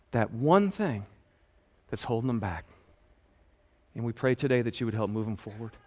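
soft, hushed pastoral prayer. Acted. Pastor praying over his congregation, hushed and earnest. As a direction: pray gently over the room.

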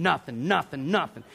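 usual speaking voice

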